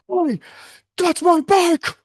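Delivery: high, loud, slow indignant shout. Retold or imitated. Friend yelling at the thief over his bike, high loud outrage. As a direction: stretch it out, outraged.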